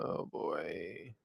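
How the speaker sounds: normal talking voice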